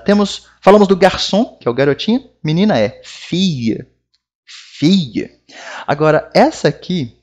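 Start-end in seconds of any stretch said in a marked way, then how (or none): 3.0-5.3 French "fille" said "fee-y"; "fille" with no L sound. Shown as a demonstration. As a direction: let "fille" end in a y glide. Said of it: "tem o som do I".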